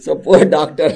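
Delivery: loud words through laughter; amused laughter. Real, not acted. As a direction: laugh along at your own punchline. Laughing at own punchline.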